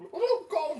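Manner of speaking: high startled cry; surprised yelp. Real, not acted. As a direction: yelp at the cold water. Face near tepid water.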